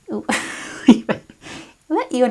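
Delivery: a lightly attempted Australian accent; mock Australian accent. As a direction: try on a jokey Australian accent, then give up. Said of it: "excuse my Australian accent".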